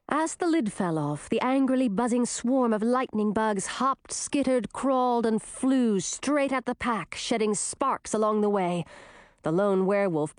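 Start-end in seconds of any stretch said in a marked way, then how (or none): none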